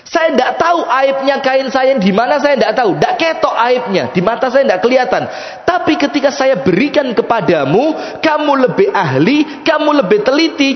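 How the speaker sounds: high-pitched, pleading outpour; impassioned lament. Acted. Weaver explains his tears to the buyer, impassioned lament. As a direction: pour out the plea, pitched high throughout.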